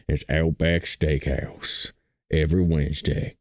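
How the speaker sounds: low, slow ad-man impression; Outback commercial voice. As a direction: stretch the tagline deep and slow. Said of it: "remember that Outback Steakhouse commercial".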